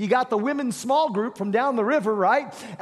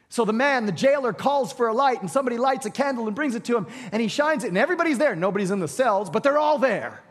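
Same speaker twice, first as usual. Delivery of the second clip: ordinary delivery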